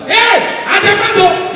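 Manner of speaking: high loud bragging yell; mock shout. Comedian acts the young man shouting his boast.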